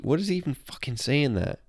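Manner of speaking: usual speaking voice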